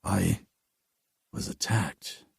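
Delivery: low, soft, slowing shaken reply; stunned soldier telling the woman what happened; shaken answer. A narrator's reading. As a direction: barely get the words out, stunned.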